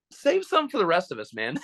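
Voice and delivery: mock exasperated jab. Mock jab at the mascot hogging the cheerleaders, teasing. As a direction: needle him like a jealous buddy.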